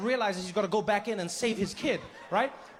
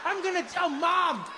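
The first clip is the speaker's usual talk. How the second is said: put-on high, whining child's accusation; outraged little kid; 9 semitones higher than usual. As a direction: wail it at dad as a betrayed child. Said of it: "I know this kid was like".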